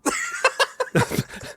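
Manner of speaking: high, breathless cackle; helpless burst of laughter; laughing. Real, not acted. Cracking up at the impressions, breathless laughter.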